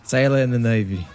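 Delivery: bad British accent drifting Irish; British accent gone Irish. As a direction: answer the lord in a rough Irish-tinged brogue. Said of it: "you're kinda like Irish or Scottish".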